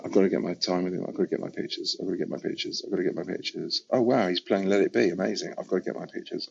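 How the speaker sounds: hushed, rushed anxious whisper to himself; half-whispered worried loop; anxious inner voice. Retold or imitated. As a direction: mutter it under your breath like a nervous mantra. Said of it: "I'm still anxious".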